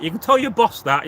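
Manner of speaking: ordinary talk, nothing marked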